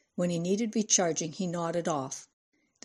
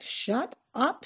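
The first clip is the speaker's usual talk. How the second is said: higher-pitched cut-in; cross retort; 6 semitones higher than usual. A narrator's reading. Cindy silencing Anne, a cross higher-pitched retort.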